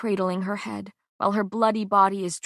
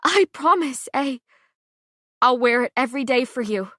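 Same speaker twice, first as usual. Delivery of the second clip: laughing through tears; 4 semitones higher, 5 dB louder than usual. Acted. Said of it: "An equal part sob and hysterical laugh".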